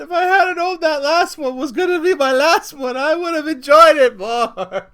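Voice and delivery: high, loud exasperated complaint, dragged at the end; loud comic lament. Acted. Married man bemoaning his last time, loud mock lament. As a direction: wail it in mock outrage.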